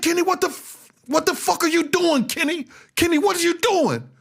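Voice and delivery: high, frantic retold yell at the driver; screaming at Kenny in a panic; high panicked shout during the shooting.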